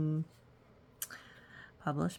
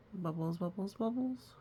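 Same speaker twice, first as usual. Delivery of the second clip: soft bubbling bubbles; 8 dB quieter than usual. Acted. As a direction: let it bubble up. Anger bubbles up.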